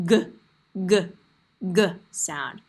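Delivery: "G" said as a hard short g sound, repeated; G sound practice. Shown as a demonstration. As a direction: model the hard "G" for the kids. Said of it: "let's practice it".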